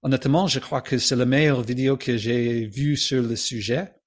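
reading in a French accent; native-style French pronunciation. Shown as a demonstration. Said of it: "So my French accent".

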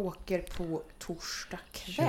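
slow, soft unvoiced whisper. Real, not acted. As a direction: hush it like a secret. Secretive whisper about camp. (viskar om kollot)

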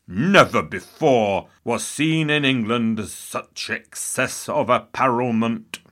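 slow, grave pulpit pronouncement; thundering bishop. Retold or imitated. Bishop John Fisher condemning the court's excess, slow and grave. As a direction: intone it like a disapproving bishop. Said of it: "he thundered".

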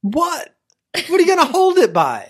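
high, loud disbelieving question; incredulous. Real, not acted. Co-host disputing how to hold pizza, incredulous. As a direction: pitch it way up in baffled protest. Playful argument over eating pizza crust first.

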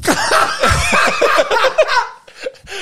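loud, high burst of real laughter. Real, not acted. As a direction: crack up loudly at the joke. Laughing at the mistaken identity.

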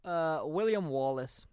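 put-on slow, drawn-out Scottish accent on the name; lightly put-on Scottish accent, said slowly. Co-host announcing the episode's subject in a slow Scottish accent. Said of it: "that's the last time I'm doing a Scottish accent".

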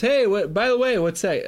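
high, loud, innocent-sounding question; hammed-up offhand curiosity; feigned casual. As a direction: pipe up as if it just occurred to you. Greylick's fake casual question.

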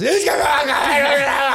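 put-on a girl sobbing to the knight about the dragon; mock sobbing girl. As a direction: wail it like a hammed-up crying girl. Crying girl answers the knight.

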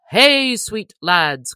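slow hearty call to the lads; cried out. A narrator's reading. Little John hailing the crowd at the ale booth, hearty call. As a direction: call out slowly, arms wide. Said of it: "cried he".

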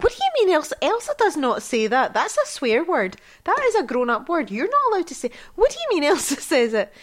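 high pitched scolding. Retold or imitated. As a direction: mum scolding her three-year-old for swearing, pitched high. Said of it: "I got very high pitched".

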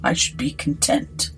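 courteous gentleman captain, formal; measured old-fashioned civility; genteel captain. Acted. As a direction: make a gracious, polished offer. Voices gentleman captain in story.

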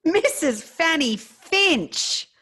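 high, slow, relishing the name; savouring the name. Pretend. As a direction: stretch it out in delight.

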